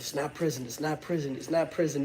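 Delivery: repeated quietly under his breath; calm yourself down, softly; reminding himself he is not in prison, quietly; self-reminder. Retold or imitated. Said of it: "I've gotta remind myself".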